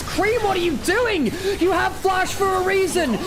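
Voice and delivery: high, drawn-out exasperated cry; emotional outburst. Real, not acted. Caster berating Cream for not flashing, exasperated. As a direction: wail it in disbelief at the player's blunder. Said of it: "getting a bit emotional about Cream".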